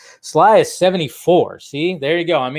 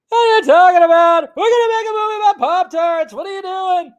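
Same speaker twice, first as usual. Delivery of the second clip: high, loud, whiny sitcom-comic voice; terrible Jerry Seinfeld; 12 semitones higher, 4 dB louder than usual. Retold or imitated. Podcast host mocking Jerry Seinfeld's movie announcement, high whiny impression. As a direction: do a bad Seinfeld, pitched way up. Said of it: "That's my terrible Jerry Seinfeld impression".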